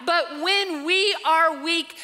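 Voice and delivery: normal talking voice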